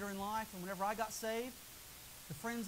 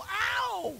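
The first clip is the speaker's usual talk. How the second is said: sharp high "Ow!" of a man being chiseled; mock cry of pain; 10 semitones higher, 9 dB louder than usual. Man in the skit yelping at God's chisel. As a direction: yelp it like the chisel just hit. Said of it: "the guy would go".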